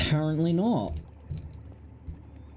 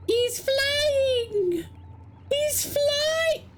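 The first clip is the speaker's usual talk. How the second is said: very high, cartoonish squeal of "He's flying"; squeaky cartoon joy; squealing delight; much slower than usual. Real, not acted. Pig thrown, squealed delight.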